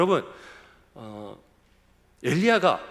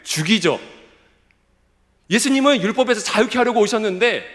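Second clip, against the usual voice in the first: loud, raised preaching voice; impassioned preaching; 5 semitones higher, 6 dB louder than usual. Real, not acted. Preacher denouncing scorn for God's prophets, impassioned preaching. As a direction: drive each phrase home forcefully. Preacher rises to full force.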